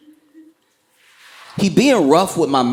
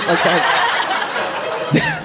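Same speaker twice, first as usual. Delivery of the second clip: ordinary talk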